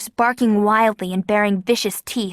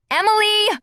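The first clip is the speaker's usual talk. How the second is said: shriek; 12 semitones higher than usual. A narrator's reading. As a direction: scream her name in alarm. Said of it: "Kara shrieked".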